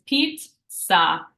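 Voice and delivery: pizza slow, parts split; phonetic breakdown. Shown as a demonstration. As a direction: break pizza into its sounds, ts clear. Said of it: "break pizza apart phonetically".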